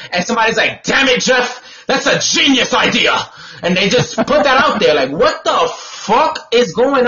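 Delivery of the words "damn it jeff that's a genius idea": hammed-up boardroom cheer, pitched up; mock excited shout. Host mocking school officials, a hammed-up excited shout. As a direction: play a dim executive thrilled by a dumb idea.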